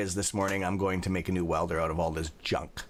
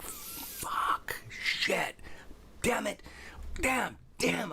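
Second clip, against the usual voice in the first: hissed curses, then high strangled damns; cursing under breath; 12 semitones higher, 4 dB quieter, much slower than usual. Retold or imitated. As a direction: swear through your teeth at the broken machine. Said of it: "I'm like".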